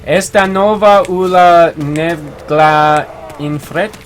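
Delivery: slow, French-sounding read of "Esta nova ula nevgla infret"; mock French reading. Shown as a demonstration. Streamer reading a viewer's Spanish comment, slow and French-sounding. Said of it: "I probably said that terrible too".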